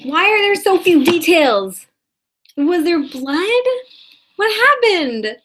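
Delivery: frantic demand. Acted. Frantic questions about the cat.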